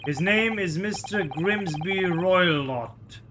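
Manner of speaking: put-on lightly Russian-accented guard, proud; a hint of a Russian accent, boasting; mock Russian guard showing off; Russian sailor voice.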